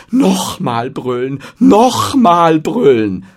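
drawn-out, hammed-up acclaim; enthusiastic shout. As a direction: roar for an encore. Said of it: "vor Begeisterung rufen wird".

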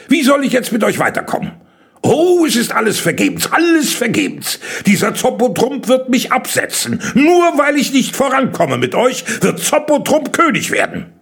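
high, agitated wailing tirade; worked-up, high-pitched despair; exasperated lament. A narrator's reading. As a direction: wail that everything is lost. Kalle despairs of losing his crown.

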